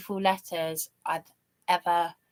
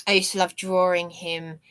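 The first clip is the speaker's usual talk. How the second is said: ordinary talk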